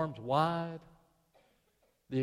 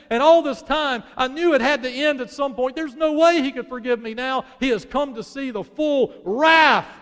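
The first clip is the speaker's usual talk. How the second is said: anguished cry; 7 semitones higher, 9 dB louder than usual. Acted. Her anguished inner thoughts, preached.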